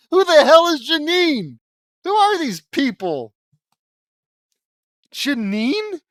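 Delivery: loud, high disbelief; baffled outburst. Real, not acted. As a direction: yell it in total confusion. Baffled outburst at unknown character.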